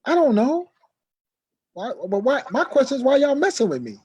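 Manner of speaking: high, loud angry retort; firing back. Retold or imitated. Answering his serious brother, loud and angry. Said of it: "I fire back off him".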